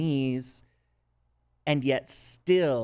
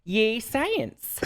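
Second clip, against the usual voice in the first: high-pitched, playful Minnesota accent; 9 semitones higher, 3 dB louder than usual. Shown as a demonstration. Repeating it on a co-host's request, in a Minnesota accent. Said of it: "Can you say that in your Minnesota accent?".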